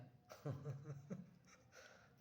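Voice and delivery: a burst of breathy high laughter; nervous laugh. Real, not acted. Laughing while telling a friend about the ghosts. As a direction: laugh it off, half embarrassed.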